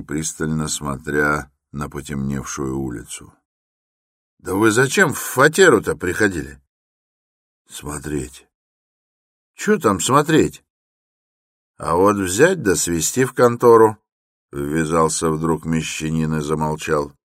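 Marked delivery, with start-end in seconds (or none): 4.4-6.6 raised, insistent question; suspicious questioning. A narrator's reading. Porter questions Raskolnikov about the flat, insistent and loud. 7.7-8.5 soft, idle one-word reply; lazy, pensive. A narrator's reading. Raskolnikov answers the porter, soft and lazy. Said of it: "как-то лениво и задумчиво". 9.5-14.0 high, loud objection; challenging. A narrator's reading. Bystanders press Raskolnikov at the gate, loud and challenging.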